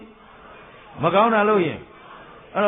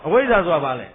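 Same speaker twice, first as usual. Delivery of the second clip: usual speaking voice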